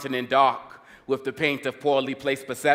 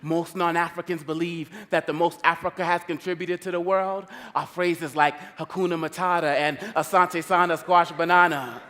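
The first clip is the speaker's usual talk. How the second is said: raised, driving rhythmic slam delivery; spoken-word poetry; 5 semitones higher, 3 dB louder than usual. Acted. Poet protests media images of Africa, driving spoken word. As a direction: punch the rhymes and keep the verse rolling. Performs rhyming poem on media.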